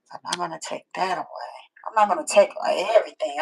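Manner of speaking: quick whispered aside; hushed quick reassurance; fast whisper. Real, not acted. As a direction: rush it in a whisper.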